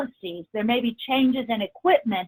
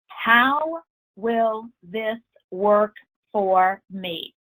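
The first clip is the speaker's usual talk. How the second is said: slow, spaced words with weight on "how"; stress on how; much slower than usual. Shown as a demonstration. Trainer gives listeners a powerful question, slowly stressing "how". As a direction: lean on "how", then set each word apart slowly. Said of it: "Notice I emphasize the word how".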